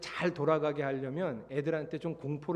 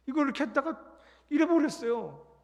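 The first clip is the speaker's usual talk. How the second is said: normal talking voice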